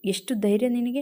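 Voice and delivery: usual speaking voice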